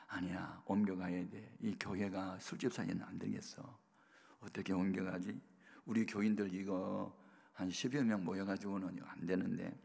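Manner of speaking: low, hushed talk to oneself; quiet musing. Retold or imitated. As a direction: think it over under your breath, half whispered. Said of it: "책상 위에 가만히 생각하니까". (혼자 생각하듯 낮게 중얼거리는 말투)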